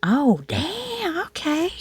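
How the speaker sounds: hearing a dentist's compliment, pleasantly surprised. Retold or imitated. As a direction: gasp it out, flattered. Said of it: "it was a really nice comment".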